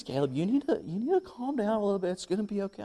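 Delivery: lightly imitated placating wife; calming wife. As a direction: soothe him in a lifted pitch. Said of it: "And she says".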